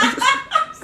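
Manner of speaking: sudden high-pitched laugh at her own joke; high burst of laughter. Real, not acted. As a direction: let a bright laugh break out. Se ríe de su propia comparación. (carcajada aguda de su propia comparación)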